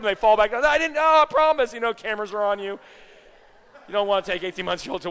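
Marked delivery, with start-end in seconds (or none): 0.5-1.7 high, loud defensive pleading; mock frantic denial to onlookers; panicked protest. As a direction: protest innocence as a flustered parent. Parent protesting innocence to onlookers.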